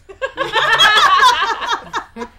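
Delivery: loud high laugh. Real, not acted. Laughing at origami joke.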